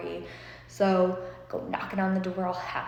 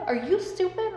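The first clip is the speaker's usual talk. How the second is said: higher, louder repeat; louder incredulous; 12 semitones higher, 4 dB louder than usual. Retold or imitated. Mom repeating herself, more exasperated. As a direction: push it harder the second time. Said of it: "my mom's like".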